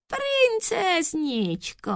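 high, slow, drawn-out cooing; sugary, thin little voice; tiny thin voice. A narrator's reading. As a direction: coo sweetly in falsetto. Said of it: "tenunkým hláskem".

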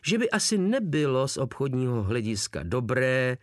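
plain reading voice

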